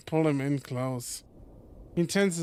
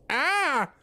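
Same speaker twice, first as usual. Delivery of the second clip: startled yelp; 9 semitones higher than usual. Acted. TJ startled, fluffs out.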